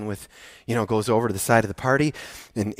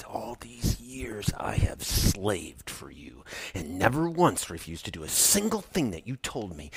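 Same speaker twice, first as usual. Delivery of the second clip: teeth-gritted spitting; 5 semitones lower, 11 dB quieter than usual. Acted. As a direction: spit each word at the father. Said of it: "gritting his teeth, just spitting these words".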